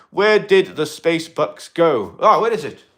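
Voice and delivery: ordinary delivery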